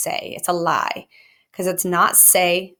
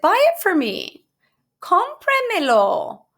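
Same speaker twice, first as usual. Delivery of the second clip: high pleading request; 12 semitones higher, 4 dB louder than usual. Acted. Daughter asking her mother to buy it, high and pleading. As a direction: squeak it out like a begging daughter.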